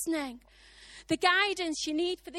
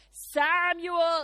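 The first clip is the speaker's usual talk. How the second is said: loud, drawn-out call of a name; mysterious voice summoning, raised and sustained; 7 dB louder than usual. Acted. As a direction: call out to a sleeper. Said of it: "he hears the voice".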